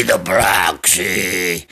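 hammed-up tearful low drawl; mock mournful. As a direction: let it sink into a fake lament. Repeated phrase for effect.

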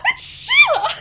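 high, loud fangirl squeal breaking into laughter; laughing gasp of delighted recognition; excited squeal. Retold or imitated. Fan recalling spotting Sheila as young Hilda, excited squeal.

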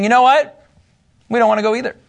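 mock defiant tribe; defiant quote. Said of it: "Gadites and Reubenites are saying".

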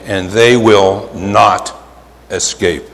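slow, each word weighted; solemn slow warning; slow emphatic quote. Shown as a demonstration. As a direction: lean on they and not. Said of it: "by saying".

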